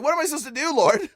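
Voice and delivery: re-enacted helpless question to God; retold plea to God. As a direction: plead upward, at a loss.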